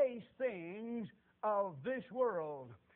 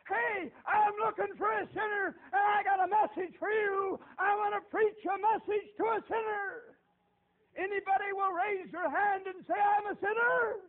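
high, loud, slow crying call; loud, high, tearful-edged street preacher call; preaching yell; 12 semitones higher, 11 dB louder than usual. Retold or imitated. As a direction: call out across the field. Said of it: "started yelling and screaming and preaching".

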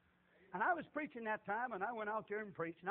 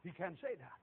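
ordinary talk

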